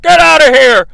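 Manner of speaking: hammed-up jeering commentator voice; mocking Gorilla. Commentator jeering at the Frenchman, high and loud. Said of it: "Gorilla's like".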